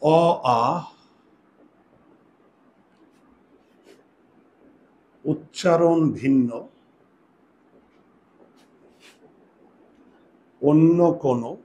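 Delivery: slow, spaced dictation for writing down; slow dictation pace. Shown as a demonstration. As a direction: dictate each part slowly so they can write. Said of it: "লিখো।".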